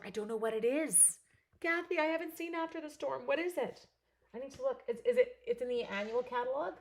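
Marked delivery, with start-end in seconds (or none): 1.6-6.7 surprised. Real, not acted. Answering viewer question.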